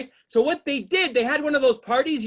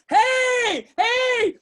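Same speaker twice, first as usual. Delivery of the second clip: 1 overeager hello; 8 semitones higher than usual. Retold or imitated. Mock waving at boat strangers.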